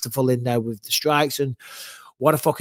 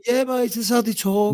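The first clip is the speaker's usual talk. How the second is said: higher-pitched Brummie demo; exaggerated Brummie, pitched up; Birmingham accent; 9 semitones higher than usual. Shown as a demonstration. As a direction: put on a high Birmingham accent. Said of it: "is that a Birmingham accent".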